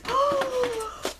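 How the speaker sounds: long, high gasp of delight; excited gasp. Real, not acted. Crafter spotting forgotten new crayons, excited gasp. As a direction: gasp at a wonderful surprise. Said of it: "totally gets me excited".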